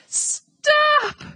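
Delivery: a pig screaming in panic. A narrator's reading. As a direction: shriek to cut the sheep off. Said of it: "screamed Wilbur".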